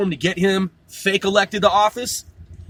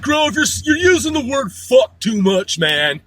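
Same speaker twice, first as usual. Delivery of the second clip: mock complaining listener; 4 semitones higher, 4 dB louder than usual. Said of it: "I know you're like".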